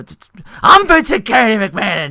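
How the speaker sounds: loud, stammering, pompous self-announcement; outraged boss. Retold or imitated. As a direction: puff up with wounded pride. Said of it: "like, you know".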